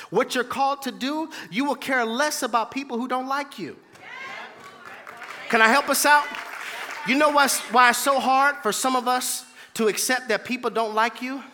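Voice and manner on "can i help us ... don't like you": louder, higher and pressing; rallying call. Acted. Preacher to congregation, louder and higher, rallying. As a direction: push it out to the pews.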